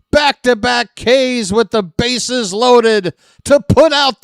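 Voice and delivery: screaming high and loud with real joy; ecstatic strikeout call. Real, not acted. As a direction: yell the strikeout call at the top of your lungs. Fan calls bases-loaded strikeout.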